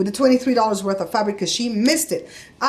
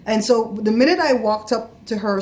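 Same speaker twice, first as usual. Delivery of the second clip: plain reading voice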